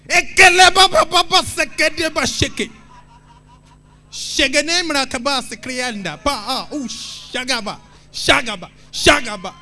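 high, loud frantic mock tongues; hammed-up noisy prayer. Shown as a demonstration. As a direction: parody a shouting prayer warrior. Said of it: "I did that to show this".

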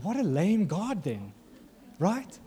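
slow, high-pitched scoff; drawn-out, swooping mock dismay; mocking retort. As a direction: answer with exaggerated pity and disbelief.

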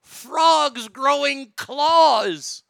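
high, loud exasperated complaint; exasperated rant. Real, not acted. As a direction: complain loudly and stretch it out. Exasperated rant about frogs.

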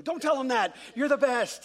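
high, loud imitated gushing; gushing praise. Daughter to dad over pancakes, imitated gushing praise. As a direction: gush it like an adoring little girl.

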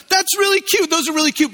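fake sweet praise.

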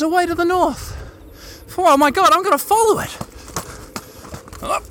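high, thrilled discovery; breathless with excitement at the sight; excited. Real, not acted. Spots a bird, thrilled.

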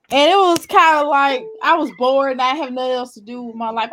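usual speaking voice

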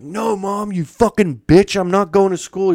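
put-on high, whiny teen voice telling off his mom; bratty teen yelling at mom. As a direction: play the spoiled kid sneering at his mother. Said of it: "the kinda guy who'd be like".

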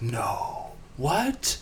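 a high, squeaky, mock-shocked "what"; mock surprise. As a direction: play the clueless host, shocked that anything is wrong. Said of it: "and he's like".

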